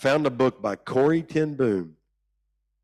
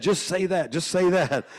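coaxing church ladies; 8 semitones higher than usual. Retold or imitated. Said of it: "they said".

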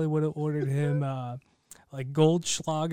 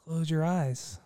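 guided meditation. Acted. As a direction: guide them on a calm imaginary trip. Leads a mock guided meditation.

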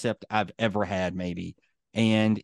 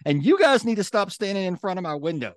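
scolding neighbour; 8 semitones higher, 3 dB louder than usual. Retold or imitated. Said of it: "He looks over at him and says".

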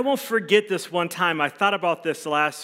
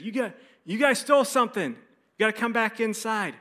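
guards' accusation; 7 semitones higher than usual. Retold or imitated. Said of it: "and they said".

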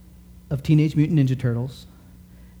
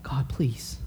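hushed prayer; 6 dB quieter than usual. Retold or imitated. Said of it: "I thought".

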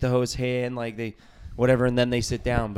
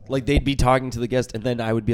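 usual speaking voice

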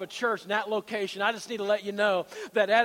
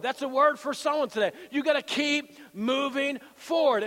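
loud, raised-pitch rallying sermon; full-force preaching; 5 semitones higher, 4 dB louder than usual. Acted. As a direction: drive each declaration home to the congregation. Preaches retold faith declaration.

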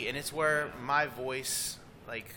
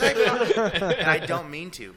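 laughing, louder, higher put-on Oklahoma twang; 6 semitones higher, 11 dB louder, much slower than usual. Shown as a demonstration. To the hosts, showing his pop's Oklahoma twang, laughing. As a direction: slip into a broad Oklahoma country twang, half laughing. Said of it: "'cause then we both start talking like this".

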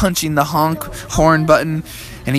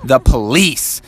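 ordinary delivery

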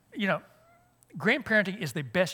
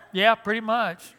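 laughing reply; 5 semitones higher, 7 dB louder than usual. Retold or imitated. Dad answering daughter, laughing.

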